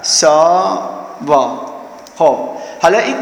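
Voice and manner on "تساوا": تساوا said very slowly and stretched out; slow demonstration. Shown as a demonstration. Teacher to students, saying تساوا very slowly. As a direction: draw تساوا out so the class can follow. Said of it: "نگاه، نگاه کنید".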